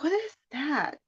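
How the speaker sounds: retold bafflement. Retold puzzlement on being woken.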